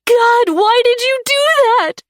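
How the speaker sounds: very high loud anguished screech. A narrator's reading. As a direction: screech the question in grief. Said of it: "I screeched".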